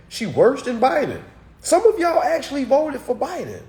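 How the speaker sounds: high, loud disbelief; incredulous outburst. Real, not acted. Streamer calling out Biden voters, incredulous and high. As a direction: blurt it out high and loud, astonished.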